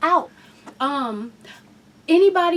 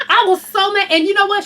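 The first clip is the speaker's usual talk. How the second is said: ordinary talk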